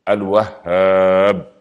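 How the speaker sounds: الوهاب drawn out very slowly, with the doubled هـ held; الوهاب, slow, leaning hard on the doubled هـ; stressed doubled h. Shown as a demonstration. Preacher teaching the name's shadda: الوهاب slow, doubled هـ. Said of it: "في شدة".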